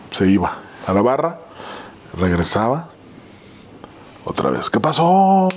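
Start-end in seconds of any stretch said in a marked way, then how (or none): 4.7-5.5 higher-pitched, drawn-out mock greeting; menacing stranger's greeting. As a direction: imitate an unwelcome stranger popping back up. Acting out the menacing stranger returning.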